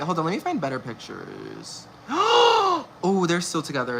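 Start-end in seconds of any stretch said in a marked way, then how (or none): 2.1-3.0 sharp gasp. Real, not acted. Gasps at photos.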